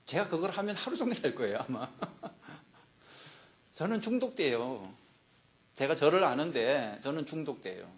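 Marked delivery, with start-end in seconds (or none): 0.0-4.9 soft self-mocking chuckle; amused confession. Real, not acted. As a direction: confess it while laughing at yourself. Laughs confessing own addictive streak.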